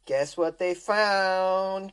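drawn-out quizmaster tease; string out the suspense; slow teasing question. Acted. Slow teasing game-show question.

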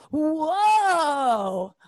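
high, stretched-out fake awe; mock amazed whoa. App voice faking awe at her speed, high and drawn out. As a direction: overdo the astonishment. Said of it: "super condescending".

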